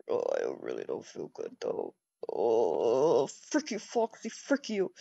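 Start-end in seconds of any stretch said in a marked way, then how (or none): 0.1-3.2 put-on low weary groan of a sick kid.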